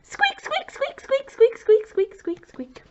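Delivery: high squeaky mouse voice, slowly sinking; mouse squeaks. Shown as a demonstration. Teacher showing children how a mouse talks, squeaky. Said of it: "What does a mouse say".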